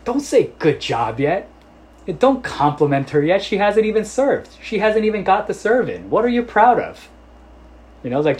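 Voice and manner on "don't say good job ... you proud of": mock-stern teasing, high and loud; playful banter from coach to a proud father; joking coach scold. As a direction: rib the dad like a fake-strict coach. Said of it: "just jokingly".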